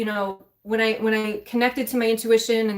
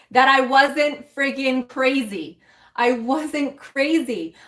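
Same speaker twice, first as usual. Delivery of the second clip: slow emphatic insistence; 4 semitones higher, slower than usual. Real, not acted. Emphatic revelation to the viewers.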